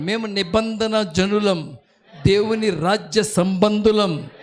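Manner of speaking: slow, deliberate confession line by line; slow declaration. Shown as a demonstration. Preacher leading the congregation's confession, slow and deliberate. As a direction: lay each word down slowly. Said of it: "గట్టిగా చెప్పాలి".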